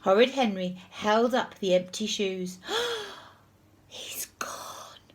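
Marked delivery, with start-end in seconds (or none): 2.6-3.1 high, sharp gasp; shocked gasp. A narrator's reading. Henry pretending his brother fell out, shocked gasp. 3.9-5.0 hushed, voiceless whisper. A narrator's reading. Henry telling the teacher his brother fell, stunned whisper. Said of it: "whispered Henry".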